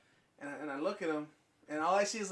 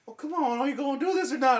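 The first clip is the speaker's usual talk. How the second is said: higher, louder, fast pushy voice; impatient stranger; 8 semitones higher, 8 dB louder, faster than usual. Retold or imitated. Retelling the stranger pressing him to friends, in a pushy high voice.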